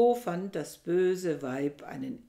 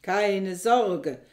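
louder, steady reassurance called back; calling out; 8 dB louder than usual. A narrator's reading. Aristippos answering Thisbe from the dark room, calling out. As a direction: call back that all is under control. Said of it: "rief Aristippos".

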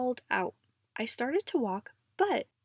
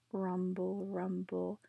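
low rumble; 4 semitones lower, 6 dB quieter, slower than usual. Acted. Avalanche noise in Grandpa's tale.

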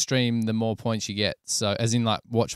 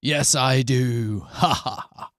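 drawn-out mock-dramatic line with a laugh; hammed-up villainous gloat; 2 semitones higher, 3 dB louder, slower than usual. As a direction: gloat slowly, playing to the camera. Hammed-up bit for a camera zoom.

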